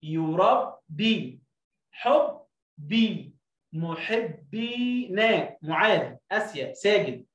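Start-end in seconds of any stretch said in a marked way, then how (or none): 0.0-5.6 يربي، حبي، محبينا drawn out, very slow; slow teacher read of محبينا; slow blending. Shown as a demonstration. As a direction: stretch each word for the class. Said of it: "تاني، أبي".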